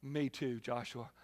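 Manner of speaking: low, soft eager crowd voice; a hint of an eager follower; crowd agreeing. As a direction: mumble along like the whole crowd. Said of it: "And everybody goes".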